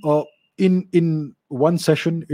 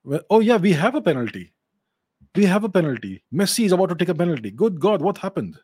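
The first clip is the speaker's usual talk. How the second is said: excited; 4 semitones higher than usual. Real, not acted. Sudden penalty during live match.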